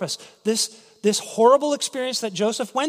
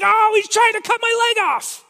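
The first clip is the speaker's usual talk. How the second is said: high, loud mock panic; cartoonish alarm, pitched high; panicked bear; 11 semitones higher, 5 dB louder than usual. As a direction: yelp like a bear in pain. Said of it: "he's like".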